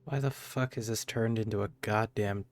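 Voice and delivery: usual speaking voice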